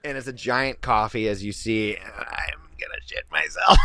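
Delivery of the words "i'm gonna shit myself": slow, strained, voiceless squeal; bowel desperation. Real, not acted. Host on camera needing the toilet, strained desperation. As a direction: squeeze it out in agonized urgency.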